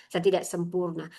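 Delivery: usual speaking voice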